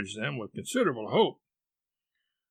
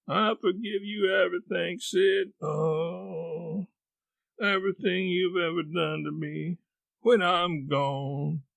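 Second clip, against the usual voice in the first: forgive nobly with your last breath; higher, sustained martyr's groan; feigned deathbed; 5 semitones higher than usual. Acted. Tom plays dying, forgiving all.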